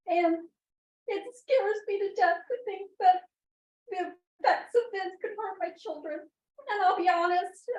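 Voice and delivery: real tearful crying. Real, not acted. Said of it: "I'm very emotional".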